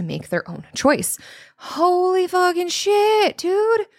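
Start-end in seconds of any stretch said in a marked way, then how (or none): 1.6-3.9 much higher, slow, drawn-out disbelief; stunned exclamation. Real, not acted. Recalling the death threats, stunned and high-pitched. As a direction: let the shock burst out high and slow.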